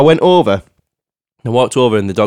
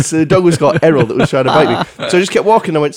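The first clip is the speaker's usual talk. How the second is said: ordinary delivery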